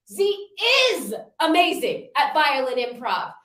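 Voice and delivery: "Z" jumped up high, the rest of the line even; stress on Z. Shown as a demonstration. Workshop host demonstrating her affirmation with the name "Z" stressed. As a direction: punch the name "Z" at the start. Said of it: "put emphasis on a different word".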